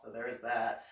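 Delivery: usual speaking voice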